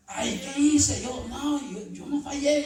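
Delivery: loud, high indignant denial; getting defensive. Retold or imitated. Rebuked man bristling and denying his fault, loud and high. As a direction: protest your innocence, flustered. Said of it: "Se enoja".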